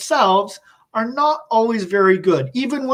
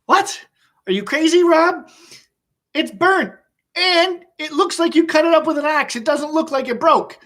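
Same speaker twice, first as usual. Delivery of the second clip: incredulous; 6 semitones higher than usual. Retold or imitated. Boys react to Rob's excuse.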